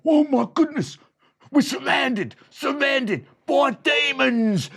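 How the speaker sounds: high, loud terrified shout; panicked bandit. Acted. Bandit hears the music and shouts in terror. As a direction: scream it as you flee. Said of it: "Scarface Bob said".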